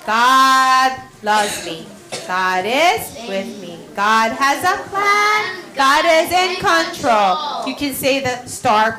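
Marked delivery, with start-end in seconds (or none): 0.0-7.7 slow, measured affirmations, partly sung; slow, steady prayer for children; slow prayer recital. Shown as a demonstration. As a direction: lead the class gently through the prayer.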